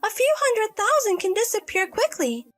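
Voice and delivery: high-pitched, self-justifying daughter; defensive adult daughter. Acted. Daughter explains the spent inheritance, defensive.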